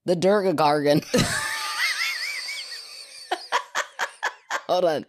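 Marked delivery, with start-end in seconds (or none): 1.1-3.0 a shrill whooping cackle; high shrieking laugh. Real, not acted. Laughs at her own botched word.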